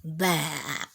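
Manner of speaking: low, soft grumpy huff; grumpy bah. Acted. Reading to kids, Skull grumbles at the happy wood.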